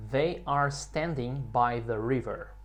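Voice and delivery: slow, measured teaching delivery; slow model sentence. Shown as a demonstration. Teacher models an example for students to repeat, slowly. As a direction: lay it out slowly to be copied.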